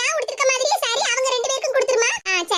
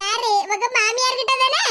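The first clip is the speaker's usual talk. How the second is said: normal talking voice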